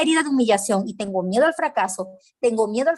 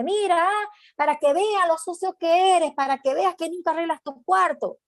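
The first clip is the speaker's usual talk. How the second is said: higher, scolding mother's voice; 8 semitones higher than usual. Retold or imitated. Mother scolding her son before his classmates, higher scolding voice. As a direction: shame the child in front of his classmates.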